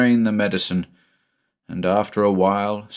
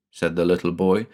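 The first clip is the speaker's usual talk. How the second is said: ordinary delivery, nothing marked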